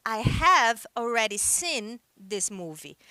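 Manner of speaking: "I have already seen this movie" said slowly, word by word; slow model sentence. Shown as a demonstration. Teacher models the British sentence for students, slow and clear. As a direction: spell it out slowly for the class. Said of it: "Então, por exemplo".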